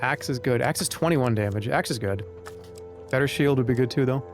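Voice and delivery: ordinary talk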